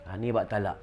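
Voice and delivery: normal talking voice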